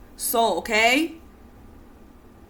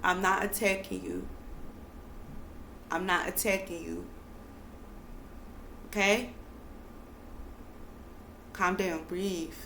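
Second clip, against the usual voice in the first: softer, gently calming the listener; soft reassurance; 5 semitones lower, 14 dB quieter than usual. Real, not acted. Speaker calming an upset listener, soft and reassuring. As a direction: hush it down, reassure them.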